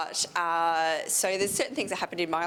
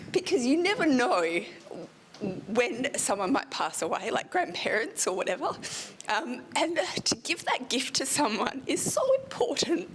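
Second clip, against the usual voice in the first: getting emotional; 7 semitones higher than usual. Real, not acted. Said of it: "Sorry, I'm gonna get emotional".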